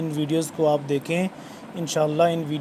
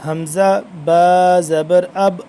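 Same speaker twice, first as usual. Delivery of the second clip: حمزہ با زبر اب spelled out slowly and louder, each letter held; slow spelling drill; 6 dB louder, much slower than usual. Shown as a demonstration. Quran teacher showing students how to spell the syllable, slow and loud. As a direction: sound out حمزہ با زبر اب deliberately, ending firmly on اب. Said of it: "اس کو ہم حمزہ کیوں، حمزہ پڑھ رہے ہیں".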